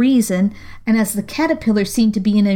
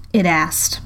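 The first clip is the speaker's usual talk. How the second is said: usual speaking voice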